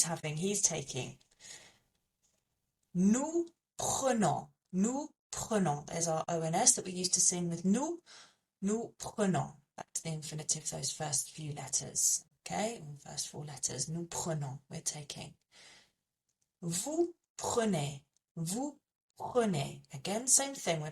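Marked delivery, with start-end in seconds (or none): 2.9-5.9 Nous prenons said slowly and carefully; slow French demo. Shown as a demonstration. Teacher modelling nous prenons for learners, slow and careful. 16.6-18.0 Vous prenez drawn out slowly; slow French demo. Shown as a demonstration. Teacher modelling vous prenez for learners, slow and careful.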